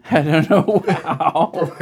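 slow, loud laughter-filled words; barely speaking for laughing. Real, not acted. Friends cracking up on podcast.

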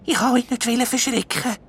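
high, halting elf voice tripping on ich; shy stammer. A narrator's reading. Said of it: "sagt der Elf schüch".

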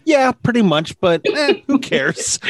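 retold shrug. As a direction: shrug it off, imitating his who-cares answer. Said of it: "he was like".

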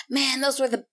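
rapid, chattering food-obsessed bard; fast-spoken bard. Acted. Bard pesters for cheese balls at a bar, fast-spoken. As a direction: rattle it off as an eager, pestering bard. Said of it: "high-pitched, really fast-spoken bard".

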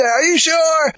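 high-pitched questioning mom; retold mother's check. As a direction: ask it high, double-checking. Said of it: "And she'd say".

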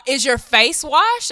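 mock scolding. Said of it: "she was like".

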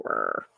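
whispered mechanical whir; robot whirring noise. Shown as a demonstration. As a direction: imitate the claw motor. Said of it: "Is that your sound effect".

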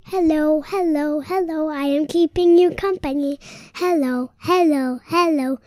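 loud repeated hellos; loud greeting. Real, not acted. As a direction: call out hello to everyone. Child greeting loudly.

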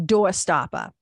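usual speaking voice

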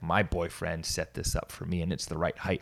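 ordinary delivery

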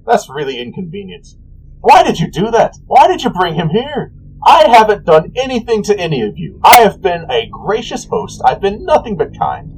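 high-pitched, put-upon whine of complaint; petulant god, rising to exasperation; aggrieved trickster god. Acted. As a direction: protest like a wronged host. Voices the aggrieved god Cyric.

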